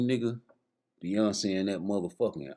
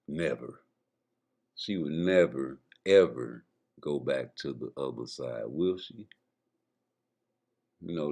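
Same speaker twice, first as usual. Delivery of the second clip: mock deep musing; 7 semitones lower than usual. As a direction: ponder it low and heavy.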